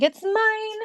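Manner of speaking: high squeaky voice for Cal; high playful "it's mine" voice; Cal's claim. Retold or imitated. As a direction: pitch it way up, a pet claiming its prize. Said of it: "Cal's like".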